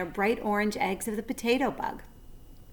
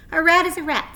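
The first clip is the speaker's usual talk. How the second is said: higher, loud spider voice; Charlotte; 9 semitones higher, 9 dB louder than usual. A narrator's reading. Charlotte scorning the rat to friends, higher. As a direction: state it firmly. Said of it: "said Charlotte".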